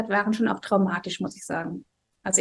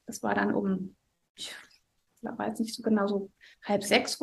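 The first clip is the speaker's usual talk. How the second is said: hushed whisper; 7 dB quieter than usual. Real, not acted. Hushed start of the emergency story.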